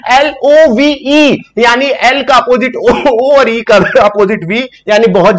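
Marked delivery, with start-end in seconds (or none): 0.1-1.4 drawn-out letter by letter; deliberate slow spelling for students. Shown as a demonstration. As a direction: spell each letter out slowly. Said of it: "spelling out slowly".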